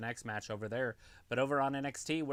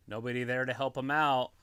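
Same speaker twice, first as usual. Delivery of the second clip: ordinary talk, nothing marked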